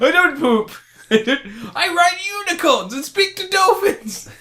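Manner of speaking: very high, loud tearful protest, hammed up; mock crying. The leader defends his myths to hecklers, mock crying. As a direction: squeal the boast like a wounded child, laughing. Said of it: "he'll run away crying, going".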